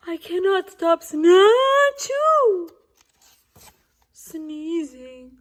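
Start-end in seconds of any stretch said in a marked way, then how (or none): 1.9-2.7 quick high achoo; sneeze. Acted. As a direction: pop out a sneeze. Sneezing fit.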